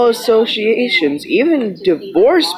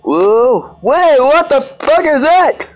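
panicked; 9 semitones higher than usual. Real, not acted. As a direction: shout in sudden fright at the head. Sudden scare in horror game.

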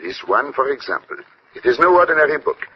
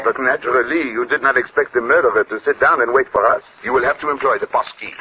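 French-tinged English of a Belgian detective; courteous Belgian French accent. Acted.

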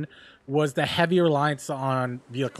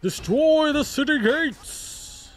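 high, slow war cry; battle cry; 10 semitones higher, slower than usual. Pretend. Player rallying the team at the siege, battle cry. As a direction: stretch it out like a rallying call.

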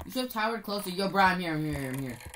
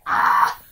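startled yell; 14 semitones lower, 9 dB louder than usual. Real, not acted. Hit by zombies.

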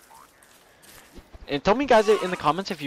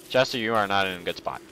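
ordinary talk

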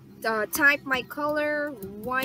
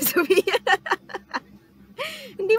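higher, louder, breaking into laughter; laughing; 8 semitones higher, 6 dB louder, faster than usual. Real, not acted. Streamer gives up on a viewer's hard name, laughing.

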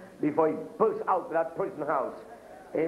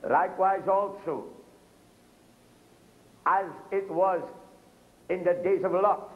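higher, proclaiming preacher's read; scripture reading; 5 semitones higher than usual. Acted. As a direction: lift the verse up to the church. Reads Luke in preaching voice.

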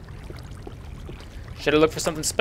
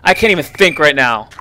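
slow, loud furious groan at a zombie; angry outburst; 3 semitones higher, 10 dB louder, slower than usual. Real, not acted. Gamer furious at a zombie, loud angry outburst. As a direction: sputter with rage. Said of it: "So angry".

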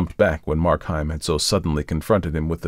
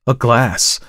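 higher, louder and clearly spoken; crisp, forceful exclamation; 5 semitones higher, 7 dB louder than usual. A narrator's reading. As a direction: cry it out clearly in disbelief. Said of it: "repeated it more clearly".

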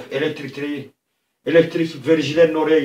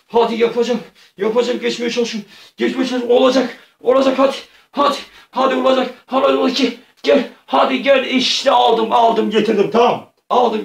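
high, loud play-acted excitement; will yourself through, voice climbing, then burst out in triumph; urgent self-urging; 8 semitones higher, 5 dB louder than usual. Player grabbing the cat on the last chance, urging self on excitedly.

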